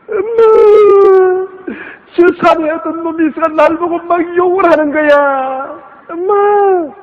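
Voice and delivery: very high, drawn-out whine for mom; acted tearful tattling to mother; wailing child. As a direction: cry out like a hurt little kid.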